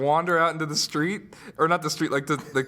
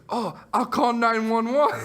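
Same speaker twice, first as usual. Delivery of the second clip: slow, higher, badly faked Jamaican accent; attempted Jamaican accent; 6 semitones higher, slower than usual. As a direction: imitate a Jamaican worker, not very convincingly. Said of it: "I can't do a Jamaican accent".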